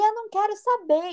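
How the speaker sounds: high-pitched mock shouting; retold outburst, pitched well up for others to overhear; showy loud refusal. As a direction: imitate him making a show of refusing. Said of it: "E tipo, fazendo mó alto".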